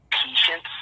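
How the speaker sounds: mock him with a thin whispery nerd voice; Bill Gates. Podcast guest mimicking how he says patience, fake nerd voice. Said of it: "his little fake nerd voice".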